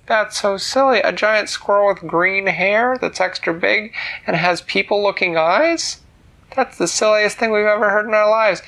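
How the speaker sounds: loud, high-pitched disbelief; scoffing friends. Acted. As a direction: pile on the ridicule as incredulous schoolmates. Disbelieving friends mock Nellie's story.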